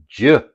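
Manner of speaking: "J" as the bare consonant sound "juh"; short, punched "juh" for "J", not the letter name. Shown as a demonstration. Teacher shows the D G sound, "J" said as a punchy "juh". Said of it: "that has a J sound".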